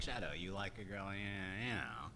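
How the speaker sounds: low, very soft murmur; hushed aside. Retold or imitated. Speaker to Shadow about his girl, low hushed aside. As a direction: mumble it low, half to yourself. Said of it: "and like".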